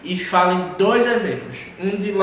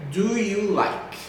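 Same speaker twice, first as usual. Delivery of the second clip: slow, drawn-out "Do you like?"; teacher's slow repeat-after-me delivery; slow model question; 3 semitones higher, much slower than usual. Shown as a demonstration. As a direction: stretch each word of "Do you like" for learners.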